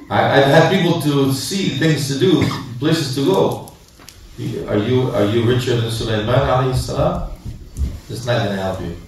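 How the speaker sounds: ordinary talk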